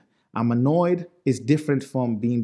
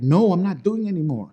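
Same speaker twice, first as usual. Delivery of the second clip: mock protest, higher and louder; mad trainee; 7 semitones higher, 5 dB louder than usual. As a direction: act out a trainee refusing in annoyance. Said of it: "That trainee is mad at you".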